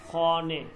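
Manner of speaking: খনে said alone, separated from বদে; broken down. Shown as a demonstration. Teacher breaks the phrase apart for the class. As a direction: isolate খনে as its own clear word. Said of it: "আমি ভেঙে দেই".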